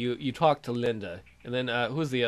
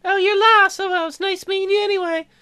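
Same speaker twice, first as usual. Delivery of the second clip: much higher and louder, a put-on young woman's voice; girly falsetto; 8 dB louder than usual. Commentator voicing Julie in high falsetto. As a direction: squeak it out like a sweet college girl.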